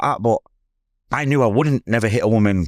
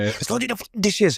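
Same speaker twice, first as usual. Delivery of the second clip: a higher, hammed-up angry mum voice; mum snapping; 9 semitones higher, 4 dB quieter than usual. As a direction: imitate mum's sharp scolding. Mum yelling at son.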